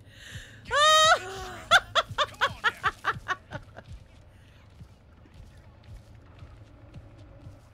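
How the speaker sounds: loud high whoop into staccato laughs; hysterical laughter. Real, not acted. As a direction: shriek with laughter, then let it die away. Laughing at Leatherface.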